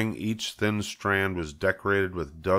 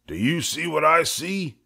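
higher, amazed question; awed farmhand; 9 semitones higher than usual. Acted. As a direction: ask in wonder. Lurvy asks in awe.

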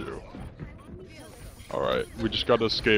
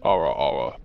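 deep ara ara; 8 semitones lower than usual. Shown as a demonstration. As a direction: drop your voice deep on ara ara. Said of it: "can you say".

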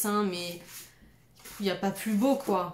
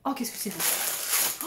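slow, whispery amazement; awed gasp; 3 dB quieter, slower than usual. Real, not acted. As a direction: breathe it out in awe. Gasps at gift's beauty. (souffle émerveillé)

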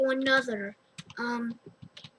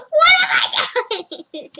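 high shout through laughter; laughing shout; 12 semitones higher, 9 dB louder, much slower than usual. Real, not acted. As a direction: yell it while cracking up. Laughing shout while playing.

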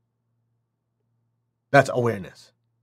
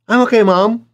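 put-on sulky kid; 8 semitones higher, 5 dB louder than usual. Child answers mother.

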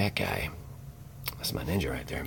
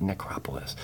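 whisper; 10 dB quieter than usual. Real, not acted. Whispered card name aside.

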